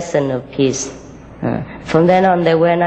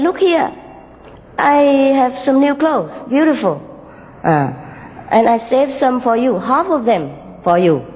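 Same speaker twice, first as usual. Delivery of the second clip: raised, higher offering voice; friendly green character; 6 semitones higher than usual. Acted. Green offers clothes to red, in a raised friendly voice. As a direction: coax a rival kindly, pitched up.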